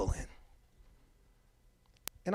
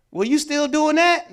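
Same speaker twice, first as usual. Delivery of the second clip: much higher, loud, slow accusing question; accusing acquaintance; 10 semitones higher, 9 dB louder, slower than usual. Acted. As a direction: hit him with a suspicious jab.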